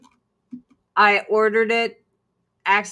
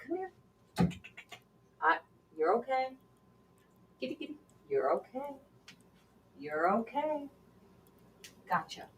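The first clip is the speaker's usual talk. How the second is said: soft coaxing; 3 semitones higher, 12 dB quieter, faster than usual. Real, not acted. Coaxing the scared cat.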